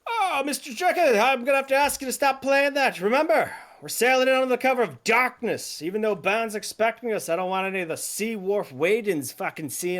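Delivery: high, loud, commanding officer voice; an officer barking at the cook to stop the racket; stern ship officer. Acted. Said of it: "He goes".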